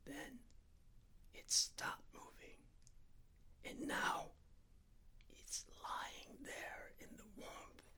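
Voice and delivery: very soft whisper. A narrator's reading. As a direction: barely breathe the words out. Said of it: "so softly I had to lean close".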